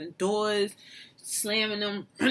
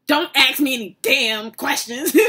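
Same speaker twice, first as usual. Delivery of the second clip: grandma with attitude; 4 semitones higher, 9 dB louder than usual. Retold or imitated. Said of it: "has an attitude".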